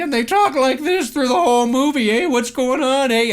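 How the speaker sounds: high, loud mock Canadian accent; hammed-up Canadian movie hoser talk; Bob and Doug McKenzie. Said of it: "where they play Bob and Doug McKenzie".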